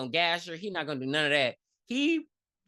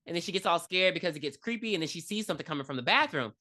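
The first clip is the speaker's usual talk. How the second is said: normal talking voice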